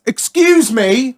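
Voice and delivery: high, slow, affronted call of Excuse me; indignant excuse me. Retold or imitated. Old driver calling out to the narrator, high and affronted. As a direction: stretch it out like a prim, offended driver. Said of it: "I hear this".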